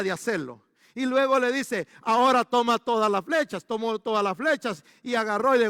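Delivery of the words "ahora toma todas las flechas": prophet orders louder, pressing each word; loud command. Retold or imitated. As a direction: call out the order like a stern prophet.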